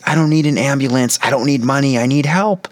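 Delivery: high-pitched acted plea of a desperate man; desperate pleading. Homeless man refuses an ambulance, pleading desperately. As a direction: insist high and distressed, as if begging. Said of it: "this desperate homeless man pleading".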